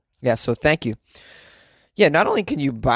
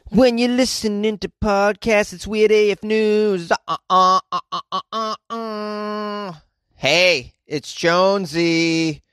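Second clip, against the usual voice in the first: higher, slow podcast intro; announcer voice; 7 semitones higher, 3 dB louder, slower than usual. Acted. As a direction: show intro, drawn out.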